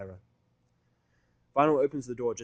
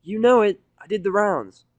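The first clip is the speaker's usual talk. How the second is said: much higher and louder, puffed-up boast; self-important Rainbow; 10 semitones higher, 14 dB louder, slower than usual. Acted. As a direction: brag it with chest puffed out. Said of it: "swells herself up with self-importance".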